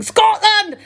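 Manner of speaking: loud, high battle cry; shouting at the top of his voice. A narrator's reading. Will shouting in his workshop, full bellow. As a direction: roar it out like a painted warrior. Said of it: "at the top of his voice".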